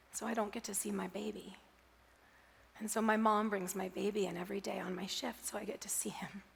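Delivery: quiet and hushed, sinking into a whisper; a grieving mother opening up softly; soft tearful confiding. Retold or imitated. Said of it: "the checkout clerk said".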